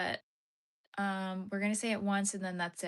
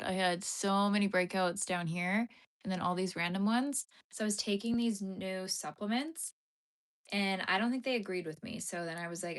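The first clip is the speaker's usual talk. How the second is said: normal talking voice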